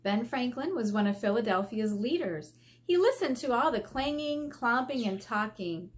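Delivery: put-on lively storybook reading for kids; gently expressive read-aloud voice; reading aloud to children. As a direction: read it like a picture book to a class.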